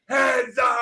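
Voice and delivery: high-pitched hammed-up holler. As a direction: re-enact a yell across the field. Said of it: "we'll say it louder next time".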